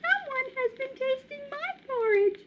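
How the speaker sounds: put-on high, tiny baby bear voice; little baby voice. Baby bear finding his chair used, tiny voice. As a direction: squeak it like a small cub. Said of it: "said Baby Bear in a little baby voice".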